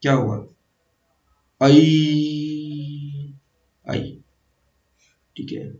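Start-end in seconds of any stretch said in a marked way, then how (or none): 1.6-3.3 आई held long on one level pitch; long steady आई for the class to copy; sustained vowel demo. Shown as a demonstration. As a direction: stretch आई out evenly like a teacher sounding it. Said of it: "आ के बाद अगर मैं ए लिखूं".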